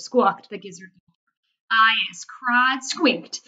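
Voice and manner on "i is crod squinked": slow, raised-volume yell; yelling giant. A narrator's reading. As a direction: yell it out in drawn-out words. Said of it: "yelled the blood bottler".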